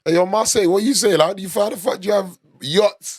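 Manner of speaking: loud, high, indignant rant at a whole city; mock outraged rant. Ranting at Marseille over its dusty pavements, loud and mock-outraged. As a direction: call the city out, hammed-up and outraged.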